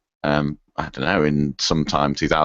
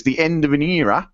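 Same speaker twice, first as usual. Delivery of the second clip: higher-pitched attempt at an Australian accent; Aussie accent; 9 semitones higher than usual. Podcast host reading a listener's farewell email, in an Aussie accent. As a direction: put on a light Aussie voice. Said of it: "read this in an Aussie accent".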